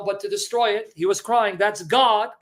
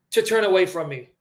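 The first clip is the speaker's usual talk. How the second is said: ordinary talk, nothing marked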